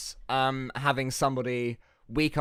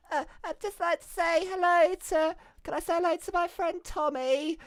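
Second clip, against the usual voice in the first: put-on high little-kid caller voice; 13 semitones higher than usual. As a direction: squeak it like a shy child. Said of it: "And the kid would be like".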